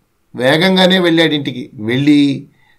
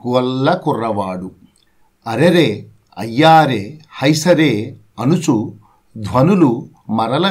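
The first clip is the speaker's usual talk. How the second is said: slow, sing-song reading of a verse; poem recitation; 4 semitones lower, slower than usual. Acted. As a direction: chant the children's poem in measured beats. (పద్య పఠనం)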